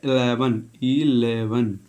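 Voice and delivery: इलेवन said clearly as "i-LE-ven"; correct pronunciation. Shown as a demonstration. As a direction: say इलेवन slowly and clearly, stress on the middle. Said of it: "ये इसकी correct pronunciation होती है". (इलेवन का सही उच्चारण, साफ़ और धीरे)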